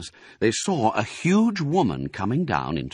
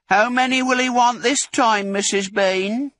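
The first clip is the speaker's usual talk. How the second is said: high, loud shout up the steps; 5 dB louder than usual. Acted. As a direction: call it up to the house. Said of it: "the woman shouted".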